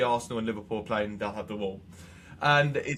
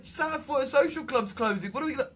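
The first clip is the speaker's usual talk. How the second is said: much higher frantic pleading; panicked disbelief; 12 semitones higher than usual. Retold or imitated. Telling wife Hazel the club is closing, high and frantic. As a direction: panic about losing the local club. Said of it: "I was like".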